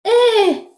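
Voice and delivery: long, high-pitched "hé" of dismay; dismayed cry. Retold or imitated. Storyteller told she must do a weave, dismayed cry. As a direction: draw out a high wail of alarm.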